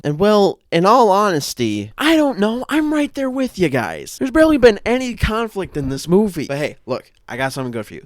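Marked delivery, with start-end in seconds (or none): 0.0-6.5 exasperated. Real, not acted. Exasperated complaint.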